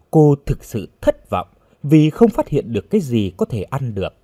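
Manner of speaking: plain reading voice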